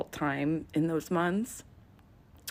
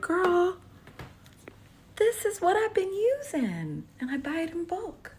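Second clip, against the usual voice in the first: much higher put-on sassy voice; sassy girl voice; 13 semitones higher, 3 dB louder than usual. Telling the babysitter her secret in a high sassy voice. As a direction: squeal it like bragging to a friend. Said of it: "I was like".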